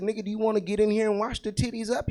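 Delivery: hammed-up dad shout; mock yell at son. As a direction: bark the dare at the kid. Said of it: "Yeah, I said".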